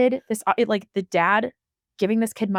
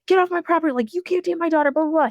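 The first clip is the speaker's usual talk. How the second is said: imitating an angry dad; 10 semitones higher, faster than usual. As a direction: shout him off the property like a furious father. Angry dad voiced, contrasted with calm.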